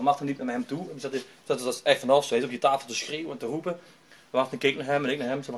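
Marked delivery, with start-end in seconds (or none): none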